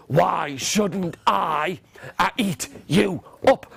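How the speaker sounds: very slow troll menace; scary troll. Acted. Troll threatening middle goat, slow menace. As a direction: draw out the threat word by word. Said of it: "up jumped the hairy, scary troll".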